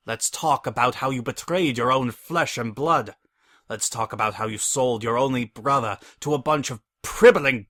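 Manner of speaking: loud, high furious tirade; hammy stage actor. Acted. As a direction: rage poured out, bigger each line. Furious tirade at traitor brother.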